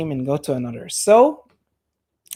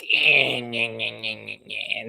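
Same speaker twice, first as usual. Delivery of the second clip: lower, softer mocking nyeh-nyeh; mocking whiner; 5 semitones lower, 3 dB quieter than usual. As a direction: sneer like someone who hates accents. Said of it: "I'm not one of those who are like".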